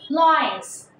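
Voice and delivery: usual speaking voice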